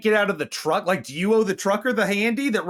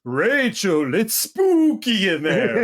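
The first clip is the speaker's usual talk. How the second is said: spooky mock Transylvanian accent, higher and louder; 6 semitones higher, 4 dB louder than usual. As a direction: ham up a Dracula-ish Transylvanian warning. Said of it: "I like how you gave him, like, the Transylvanian accent".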